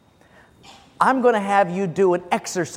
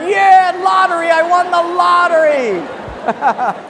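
high shouted glee, then a laugh; mock lottery winner; 9 semitones higher, 4 dB louder, slower than usual. Pastor mimics congregants holding extra wallets, shouted mock glee. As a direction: cheer like you just won the lottery. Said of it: "the people at the end are going".

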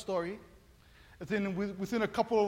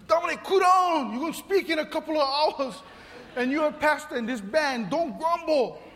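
higher, louder urgent talking himself down; self pep talk; 6 semitones higher, 5 dB louder than usual. Retold or imitated. Pastor scolding himself to stop grumbling, urgent self talk. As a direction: scold yourself to calm down. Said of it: "I was going".

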